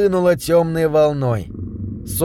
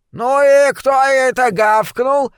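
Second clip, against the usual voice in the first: high, slow, scolding challenge; high-pitched, affronted old midwife; indignant old woman; 10 semitones higher, 4 dB louder, slower than usual. A narrator's reading. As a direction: demand the culprit as an outraged granny. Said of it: "возмутилась миссис Мишон".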